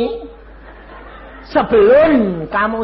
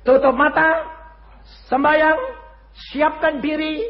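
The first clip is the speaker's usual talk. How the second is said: high, measured orders with pauses; spaced-out instructions; 9 semitones higher than usual. Retold or imitated. Preacher tells his ailing wife to prepare, high spaced commands. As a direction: call out each step separately, pitched high.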